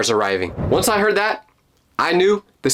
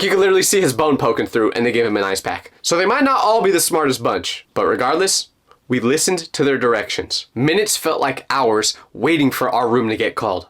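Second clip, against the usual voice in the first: usual speaking voice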